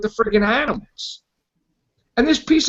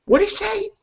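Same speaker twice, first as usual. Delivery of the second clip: confused; 14 semitones higher, 4 dB quieter, faster than usual. Retold or imitated.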